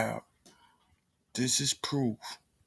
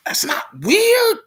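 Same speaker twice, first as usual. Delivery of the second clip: very high squeak of disbelief; squealing mock; 4 dB louder than usual. Real, not acted. Vlogger reacting to the guest, mock high squeal.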